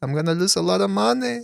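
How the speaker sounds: put-on high-pitched Yiddish accent. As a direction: whine it like an old Yiddish man. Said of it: "and he said".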